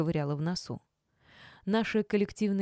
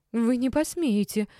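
high, loud, drawn-out indignation; indignant; 6 semitones higher, 5 dB louder than usual. A narrator's reading. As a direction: protest in outrage, slowly. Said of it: "ещё больше возмутилась Валеева". (возмущённо, высоко и громко)

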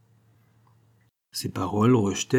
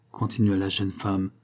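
ordinary delivery

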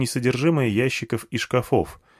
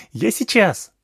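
put-on high, excited child voice; bubbly little girl, much higher; 11 semitones higher, slower than usual. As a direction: call it out like an eager small girl.

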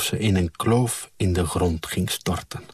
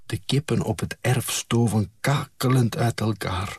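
usual speaking voice

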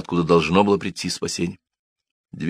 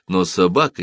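plain reading voice

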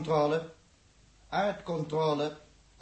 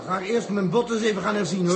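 fast and raised, half joking; loud hurried grumble; 4 semitones higher, 8 dB louder, faster than usual. Acted. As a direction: rush it out loudly over the noise. Crew member grumbling during burn.

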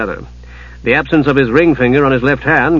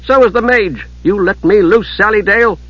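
insistent higher-pitched demand; protesting; 7 semitones higher than usual. A narrator's reading. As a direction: insist firmly in a raised pitch. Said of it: "Usha protested".

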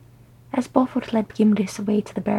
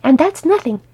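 loud, high, dismissive comeback; dismissive retort; 7 semitones higher, 8 dB louder than usual. Acted. Wife countering Glóin on the age gap, dismissively. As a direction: brush the objection aside emphatically.

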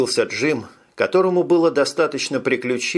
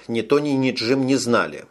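usual speaking voice

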